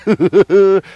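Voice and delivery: a very high, loud burst of laughter; frog's laugh. Acted. Frog laughing up at crow, very high loud laugh.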